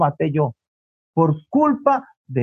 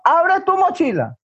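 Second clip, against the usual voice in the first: much higher, escalated motherly command; insistent order; 9 semitones higher than usual. Acted. Mother overrules her son's refusal, acted insistent command. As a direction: insist sharply over the child's protest.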